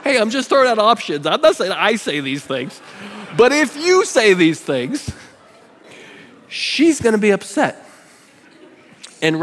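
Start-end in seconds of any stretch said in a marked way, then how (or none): 0.0-7.8 raised joking protest. Real, not acted.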